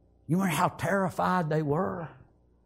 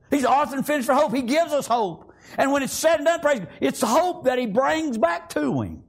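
fervent preaching; 5 semitones higher than usual. Acted. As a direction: preach it out with fire. Preaching at full force.

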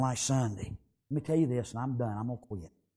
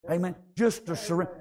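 ordinary delivery

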